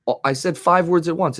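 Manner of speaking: five words said without a break, in one phrase; a quick demo of speech with no word breaks; run together in one breath. Shown as a demonstration. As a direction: say it as one unbroken phrase, no gaps between words. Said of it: "I said it without stopping".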